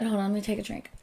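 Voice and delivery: ordinary delivery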